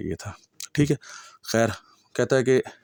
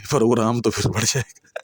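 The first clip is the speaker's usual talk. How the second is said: laughing; 3 semitones higher, 7 dB louder than usual. Real, not acted. Laughs at own slip.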